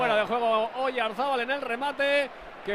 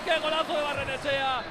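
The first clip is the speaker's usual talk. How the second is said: normal talking voice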